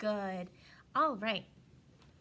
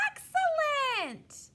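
high, loud cheering praise; excited praise; 6 dB louder, much slower than usual. Acted. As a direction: cheer it out like a proud teacher. Cheering a child's answer.

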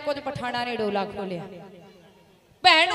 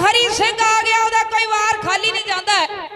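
high, loud alarm cry; panicked shout; 7 semitones higher, 8 dB louder than usual. A narrator's reading. Fleeing soldiers crying out the warning, loud and panicked. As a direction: shout the warning as you run.

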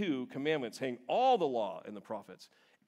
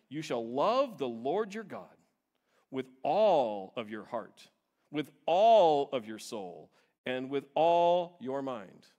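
each "all" slow and punched louder; stress on all; 6 dB louder, slower than usual. Shown as a demonstration. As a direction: lean hard on every "all" in "with all of your heart". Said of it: "Yes, I'm emphasizing the word all".

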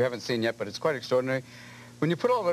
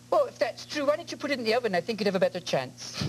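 gentle Scottish lady; 4 semitones higher than usual. Retold or imitated. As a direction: coo it like a gentle Scottish auntie. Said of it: "a line for a Scottish sex line".

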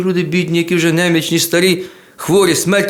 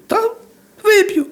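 squeaky high eager little voice; old woman's eager yes; slower than usual. Acted. Old woman gladly accepts the shoemaker's vodka, high and eager. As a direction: pipe it up high like a tiny old granny.

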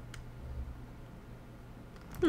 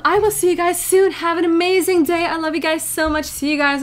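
higher and louder, bright goodbye; cheerful sign-off; 5 semitones higher, 5 dB louder than usual. Real, not acted. Signing off to viewers, loud cheerful goodbye. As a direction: beam it at the audience.

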